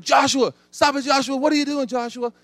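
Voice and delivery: high, loud alarmed wife calling out; wife shouting him awake, high and loud. Retold or imitated. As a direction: call his name sharp to wake him.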